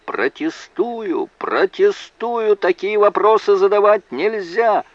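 high-pitched roar, the first words drawn out; roaring objection. A narrator's reading. Prosecutor objecting to the lawyer's question, roaring. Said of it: "Бакли заревел".

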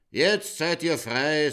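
slow, loud declaring; grand proclamation. Acted. The king proclaims to the nuns, slow and loud. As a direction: proclaim freedom grandly and slowly. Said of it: "sagt der König Purzelbaum".

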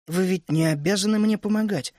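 ordinary delivery

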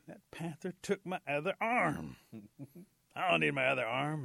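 hammed-up indignant rabbit; defiant rabbit. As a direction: push it out stubbornly, stretching the words. Defiant rabbit lost other arm.

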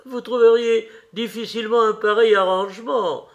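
plus fort et plus haut, amusé; crié en riant. A narrator's reading. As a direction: lancer la réplique d'un ton moqueur et sonore. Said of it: "cria Lord Henry en éclatant de rire". (Lord Henry s'exclame, amusé par Dorian, plus fort)

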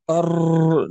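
rolled R held out in "أر"; trilled ra. Shown as a demonstration. Teacher invites everyone to try it, trilled ra. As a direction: let the tongue tap repeatedly on "أر". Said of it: "يعني يمس أكثر من مرة".

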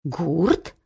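low, soft questioning; pondering coachman. A narrator's reading. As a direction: ask it low, mulling the name. Coachman Bar's puzzled question.

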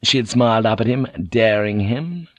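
ordinary delivery

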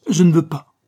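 loud, high-pitched angry refusal. A narrator's reading. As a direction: put your foot down furiously. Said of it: "eut un sursaut de colère".

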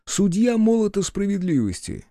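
high, amused disbelief at the title; incredulous mockery. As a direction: repeat it as if it's absurd. Хотелось расхохотаться.

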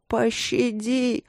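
high, long-drawn wail for mercy; howled plea. A narrator's reading. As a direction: wail it out like a begging old crone. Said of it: "завыла старуха".

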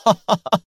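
high, amused chuckle; gloating "ha-ha-ha"; laugh. A narrator's reading. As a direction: break into a short laugh. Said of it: "ضحك مرة أخرى قائلا".

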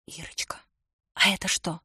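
put-on girl's hushed whisper; barely audible whisper. A narrator's reading. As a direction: whisper the question in a theatre. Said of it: "еле слышно спросила она". (еле слышный шёпот)

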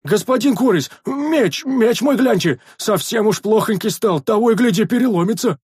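very high wailing plea; pleading yell. A narrator's reading. Sentry begging for sword magic, high yelling. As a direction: howl a request from afar. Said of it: "завопил дозорный".

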